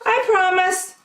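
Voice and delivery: very high, small hamster promise; little hamster voice. A narrator's reading. Little hamster promises Bunny, tiny and high. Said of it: "little hamster sniffed".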